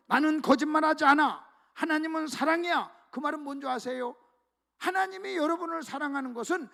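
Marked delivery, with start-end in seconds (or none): 0.1-2.9 voicing God, loud and firm; louder solemn declaration; loud proclamation. God's self-introduction, proclaimed.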